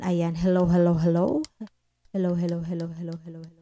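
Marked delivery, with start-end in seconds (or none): 2.1-3.5 hellos fading down to nothing; repeated mic-check hellos, volume turned steadily down; voice disappearing. Shown as a demonstration. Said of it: "Nawala na yung boses ko". (humihinang boses hanggang mawala)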